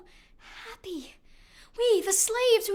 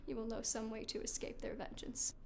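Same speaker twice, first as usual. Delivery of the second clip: hushed; 8 semitones lower, 16 dB quieter than usual. Acted. Quiet warning to Medea.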